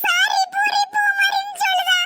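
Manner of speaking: high, drawn-out crying whine; tearful complaint. Acted. Child telling on her sister to mother, crying. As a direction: wail it to get mother's support. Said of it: "அழுதுட்டு இருக்கா".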